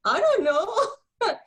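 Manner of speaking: high, slow, tearful laugh; half-laughing, half-crying it out; laughing through tears. Real, not acted. Mother recalling the pediatrician visit, laughing through tears.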